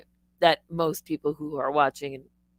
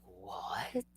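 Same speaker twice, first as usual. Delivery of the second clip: hushed disbelief; 8 semitones lower, 17 dB quieter than usual. Retold or imitated. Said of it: "even she was like".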